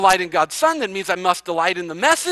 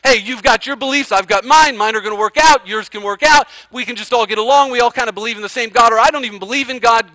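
mock worldly neighbor; 3 semitones higher, 6 dB louder, faster than usual. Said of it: "that's what they want to hear".

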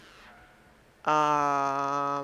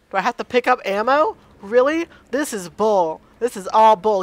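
exasperated rant; 4 semitones higher, 7 dB louder than usual. Real, not acted. Annoyed he must find ammo.

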